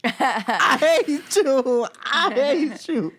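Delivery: high, slow, laughing; laughing whine. Real, not acted. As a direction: moan it through laughter. Laughing mock despair.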